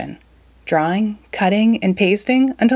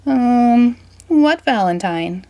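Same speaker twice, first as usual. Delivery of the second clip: uneasy; 5 semitones higher, 4 dB louder, slower than usual. Acted. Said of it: "shifted his feet uneasily".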